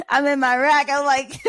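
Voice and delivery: high-pitched, laughing through the words; real laughter breaking up the story. Real, not acted. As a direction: tell it while cracking up. Laughing while recalling a scary night.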